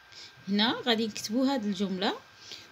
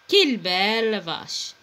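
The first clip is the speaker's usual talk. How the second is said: slow admiring exclamation, quelle stressed; 3 semitones lower, slower than usual. Shown as a demonstration. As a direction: stress quelle and let belle vache fall. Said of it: "يعني مختلف".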